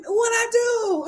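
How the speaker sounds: high-pitched imitation of baffled innocence; mock innocent protest. Boyfriend answers his tearful girlfriend, mock innocent protest. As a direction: play the wrongly accused boyfriend.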